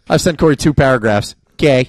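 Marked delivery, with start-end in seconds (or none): 1.6-1.9 a lightly mocked one-letter reply; reading a friend's text in a mock terse voice. Shown as a demonstration. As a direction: say K like a curt text. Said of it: "That's how I read Corey's text messages".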